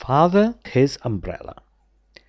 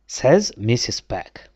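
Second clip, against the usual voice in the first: usual speaking voice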